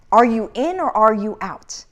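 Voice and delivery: ordinary talk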